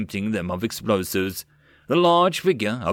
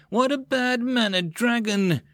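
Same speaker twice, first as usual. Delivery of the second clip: much higher, slow theatrical scolding; dramatic mock exasperation; 12 semitones higher than usual. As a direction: ham up the offended sigh. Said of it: "Mills sighed dramatically".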